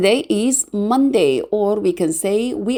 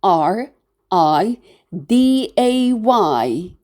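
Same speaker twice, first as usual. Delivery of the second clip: F R I D A Y, one letter at a time; F, R, I, D, A, Y as separate letter names; spelled letter by letter; slower than usual. Shown as a demonstration. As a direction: spell out F R I D A Y slowly. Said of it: "We write it as".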